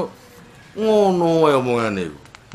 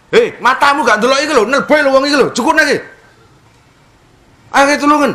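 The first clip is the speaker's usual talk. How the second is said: high, loud rough call for help; Tambak Rejo shouting; 9 semitones higher, 5 dB louder, faster than usual. Retold or imitated. Villager from Tambak Rejo shouting at bystanders to help. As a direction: yell at the crowd to come help. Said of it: "Nek teko Tambak Rejo".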